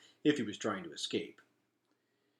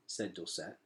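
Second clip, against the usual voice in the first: plain reading voice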